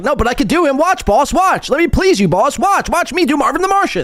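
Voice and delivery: a groveling sidekick begging for attention; eager high-pitched servant. Retold or imitated. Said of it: "But Tommy's like".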